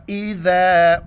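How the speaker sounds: melodic, drawn-out tilawat; Quran recitation. Acted. Teacher recites the verse line for students, melodic tilawat. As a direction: sing the verse slowly.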